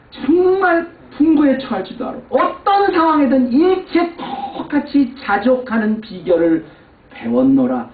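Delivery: high, loud and slow proclamation; slow fervent preaching. Acted. Pastor quoting Paul to the church, slow loud preaching. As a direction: proclaim each word slowly and fully.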